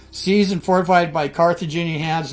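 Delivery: roman orator. Acted. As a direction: declaim it like a Senate decree. Reading Rome's war declaration aloud.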